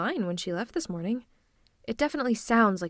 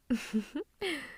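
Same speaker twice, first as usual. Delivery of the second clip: short, high closed chuckle; amused villain chuckle; 6 semitones higher, 4 dB quieter than usual. Acted. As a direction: chuckle at the captive's anger. Villain amused by captive's anger.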